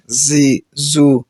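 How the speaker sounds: زی and زو slow with a clear hiss; teacher demonstrating whistling letters; whistled z demo. Shown as a demonstration. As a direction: stretch the whistle on زی زو. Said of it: "سیٹی کے ساتھ پڑھیں گے".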